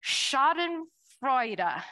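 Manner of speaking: Schadenfreude spelled out slowly, syllable by syllable; slow careful Schadenfreude with falling close; German word said out. Shown as a demonstration. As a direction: lay out each part of Schadenfreude, German style. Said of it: "this word in German called".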